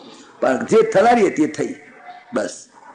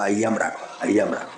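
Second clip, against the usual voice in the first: soft emphasis; 8 semitones lower, 7 dB quieter, faster than usual. Shown as a demonstration. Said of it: "અહીંયા રાખશો તો ફાવશે".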